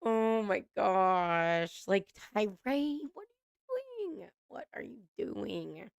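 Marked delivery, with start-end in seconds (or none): 3.1-4.3 high squeaky "what are you doing" in disbelief; squealed disbelief. Real, not acted. Watching Tyree on the show, high cringing squeal. 4.5-5.9 quiet deflated repeat. Real, not acted. Cringing at Tyree, soft deflated repeat.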